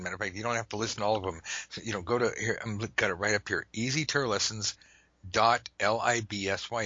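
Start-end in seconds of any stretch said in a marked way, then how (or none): none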